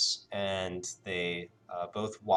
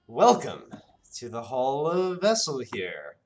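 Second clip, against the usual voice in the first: lightly voiced grand genie, louder; genie host; 5 semitones higher, 6 dB louder than usual. Genie welcoming the party to his hall, lightly voiced grandeur. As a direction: puff up and welcome them grandly.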